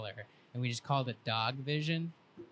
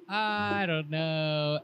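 doubtful; 4 semitones higher, 5 dB louder, much slower than usual. Retold or imitated. Hesitant about the roof jump.

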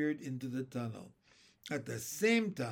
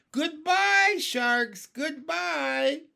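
very high, loud farewell shout; sing-out, long high call; gleeful cry; 12 semitones higher, 8 dB louder, much slower than usual. A narrator's reading. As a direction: holler a triumphant goodbye. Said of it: "they cried".

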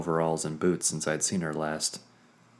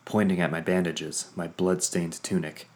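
ordinary delivery, nothing marked

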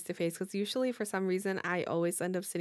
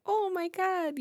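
high surprised gasp of a mom; 10 semitones higher, slower than usual. Retold or imitated. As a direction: play the amazed mother. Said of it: "And then she's like".

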